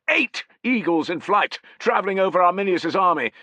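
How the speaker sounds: high, loud, elated; overjoyed, voice lifted high; excited. Acted. Centurion ecstatic at the eagles, telling his men. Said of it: "beside himself with excitement".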